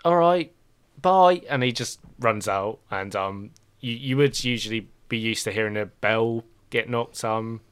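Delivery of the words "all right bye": rising, louder bye; hasty goodbye. Acted. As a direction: rush off out the door. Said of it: "he's like".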